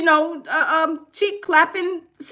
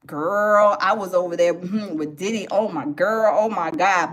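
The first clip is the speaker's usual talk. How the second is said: lower, sly gossiping voice as Sarah; smug Sarah; 11 semitones lower than usual. Retold or imitated. Sarah answering, acted voice.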